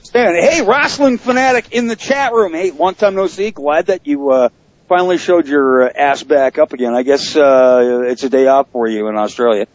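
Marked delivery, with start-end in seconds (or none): 0.0-3.5 high-pitched excited hail; yelling greeting. Real, not acted. Host shouts out to a chat room regular, yelling greeting. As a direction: call out brightly to someone across a room. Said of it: "Did you just yell at the chat room?".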